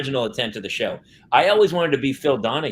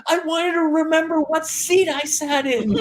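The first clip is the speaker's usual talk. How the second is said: put-on very high, innocent child's voice; little girl voice. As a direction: say it like a sweet little kid explaining herself. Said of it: "And she goes".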